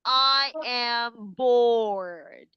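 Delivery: very slow, dragged-out I am bored. Shown as a demonstration. Teacher acting bored for the class, dragging every word. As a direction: drag it out like you have nothing to do. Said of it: "Next, we have".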